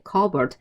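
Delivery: "커버드" with a silent P; correct cupboard pronunciation. Shown as a demonstration. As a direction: say "커버드" like CUB-erd, no P sound. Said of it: "다시 한번 발음". (P 소리 없이 "커버드"로 발음)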